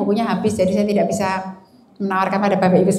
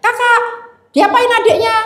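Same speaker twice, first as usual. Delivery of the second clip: put-on high, loud scolding yell at the child; angry yell; 12 semitones higher, 4 dB louder than usual. Mother yelling at troublemaker daughter, high angry yell. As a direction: yell at the eldest as if she hurt her sisters. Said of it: "saya akan berteriak".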